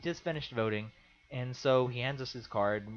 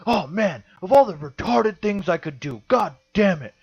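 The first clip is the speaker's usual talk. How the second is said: loud, raised, fed-up outburst; exasperated rant; 6 semitones higher, 10 dB louder than usual. Retold or imitated. Quoting a voter furious at his own mistake, exasperated rant. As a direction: imitate a man cursing himself in frustration. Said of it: "he storms off into the back".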